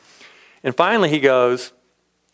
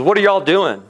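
mock-gruff old cowboy, pitched higher; retold gruff cowboy, higher and louder; 6 semitones higher, 4 dB louder than usual. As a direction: imitate a gruff rancher's challenge. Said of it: "he's real gruffy".

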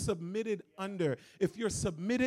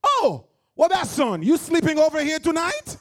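loud, high Haitian mom scolding; mother with thick Haitian accent shooing the boyfriend home; Haitian mother's accent; 8 semitones higher, 10 dB louder than usual. Retold or imitated. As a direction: play the Haitian mother fed up at one in the morning. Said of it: "her Haitian mother and her big Haitian accent be like".

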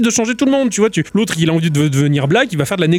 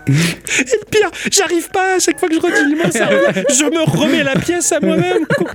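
high, squeaky words through laughter; helpless laughter; 9 semitones higher than usual. Real, not acted. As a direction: try to talk while cracking up. Cracking up at own joke.

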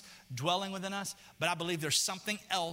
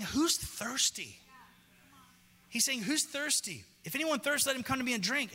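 preached call; 8 semitones higher than usual. Acted. Voicing Jesus' call.

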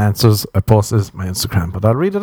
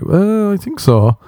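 mock coy voice, raised pitch; high, slow, sheepish answer; 10 semitones higher, slower than usual. Comic mocking a faker answering, in a high coy voice. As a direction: play it sheepish and evasive. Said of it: "And be like".

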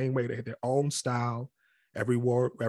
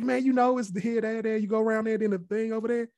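high-pitched, insistent directions; animated mock direction-giving; imitated exasperated explaining; 10 semitones higher than usual. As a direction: act out a friend explaining the obvious. Imitating someone giving vague directions.

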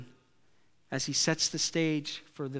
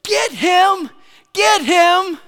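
much higher, loud shouting fan; crowd yell; 14 dB louder, much slower than usual. Retold or imitated. Football fans yelling at the safety to tackle, loud shout. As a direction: bellow it from the bleachers. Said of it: "half the crowd would be yelling".

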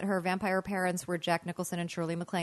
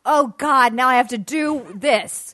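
livid exasperation; 3 semitones higher, 8 dB louder, slower than usual. Retold or imitated. Student waking up livid at the task, exasperated. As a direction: complain loudly, dragging out the last words. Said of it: "I was livid and I woke up".

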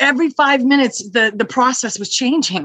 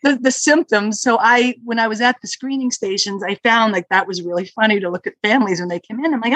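ordinary delivery

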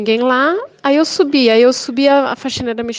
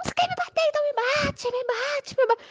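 much higher, softer taunting whine; caricatured provoking neighbor; 6 dB quieter than usual. Retold or imitated. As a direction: goad her shrilly. Neighbor provoking her to hit.

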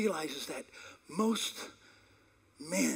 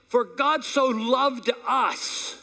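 slow, loud, higher, stretched out; preached scripture; 7 semitones higher, 8 dB louder, much slower than usual. Acted. Preacher quoting the Bible to the congregation, slow proclaiming. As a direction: proclaim each word from the pulpit.

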